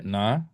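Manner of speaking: NA short and rising; second-tone demo. Shown as a demonstration. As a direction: lift NA in a brief second tone. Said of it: "nada dua lebih pendek".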